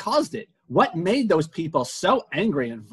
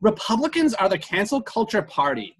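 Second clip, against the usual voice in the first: put-on valley girl voice; 6 semitones higher than usual. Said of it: "giving her the girly girl, valley girl voice".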